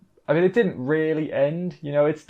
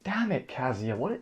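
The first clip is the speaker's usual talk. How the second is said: much softer repeat, under the breath; muttered under breath; 8 dB quieter than usual. Real, not acted. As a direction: mutter it to yourself in defeat. Viewer scolding a character.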